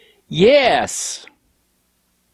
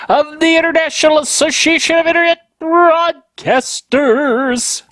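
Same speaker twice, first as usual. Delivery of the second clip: stretched, higher announcer read; grand announcer; 10 semitones higher than usual. Acted. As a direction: savor the title like a proud announcer. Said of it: "doing that just makes me happy".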